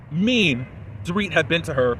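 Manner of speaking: ordinary delivery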